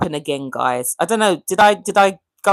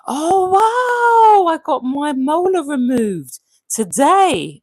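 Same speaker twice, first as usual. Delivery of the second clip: high, loud gasp of surprise; surprised; 9 semitones higher, 5 dB louder, slower than usual. Real, not acted. Reading a viewer's comment about a tooth, surprised. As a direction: react with big astonishment.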